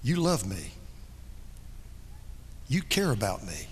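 tender hush. Acted. Voicing unbelievers meeting Jesus.

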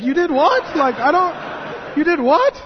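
high, loud astonished you did WHAT; disbelief. Real, not acted. Reacting to Packer's firing, loud astonished disbelief. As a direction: blurt out utter amazement. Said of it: "It's like".

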